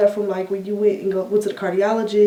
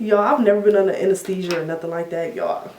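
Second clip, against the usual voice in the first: ordinary delivery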